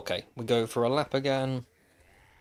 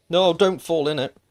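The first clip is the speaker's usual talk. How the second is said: loud, high alarmed cry; panicked shout; 6 semitones higher, 9 dB louder than usual. Real, not acted. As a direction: yell in sudden panic.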